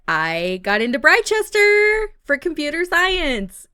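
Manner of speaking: play-acted thrilled news; excited announcement. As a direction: belt out the good news. Boyfriend announces acceptance news.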